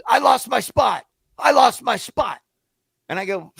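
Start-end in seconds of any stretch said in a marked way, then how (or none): 0.1-2.4 very high, strained complaint; mock yelling. Cabbie yells at rider about his lost spot, aggrieved. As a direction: imitate a cabbie wailing in protest. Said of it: "yelling at each other".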